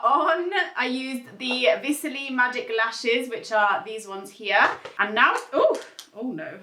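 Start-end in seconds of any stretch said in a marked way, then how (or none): none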